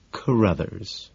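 softly delivered name; hushed, careful reveal. A narrator's reading.

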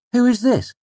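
high, quick puzzled voice; curious Bast. A narrator's reading. Bast's voice.

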